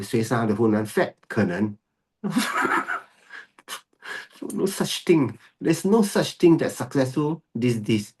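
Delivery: normal talking voice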